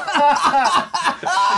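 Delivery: loud high laugh; high burst of laughter. Real, not acted. Big laugh at quote.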